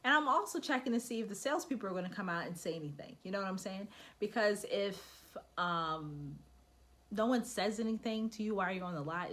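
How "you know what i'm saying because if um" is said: usual speaking voice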